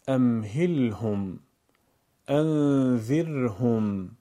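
امهلهم and انذرهم drawn out very slowly; held sukun letters. Shown as a demonstration. Teacher shows learners marked words: امهلهم، انذرهم stretched slowly. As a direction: linger on the closed consonants in امهلهم and انذرهم. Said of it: "نگاه کنید تو تصویر".

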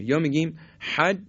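normal talking voice

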